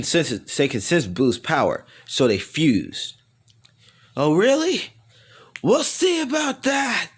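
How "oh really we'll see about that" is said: very high creature voice, mocking challenge; high, drawn-out taunting cry; villain's taunt. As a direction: squeal it like a cartoon monster. Boo taunts his fused opponents.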